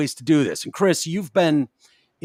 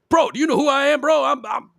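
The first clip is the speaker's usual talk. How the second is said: a radio host mocking himself pulling rank on a cop; mock entitled celebrity; 10 semitones higher than usual. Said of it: "I would never be like".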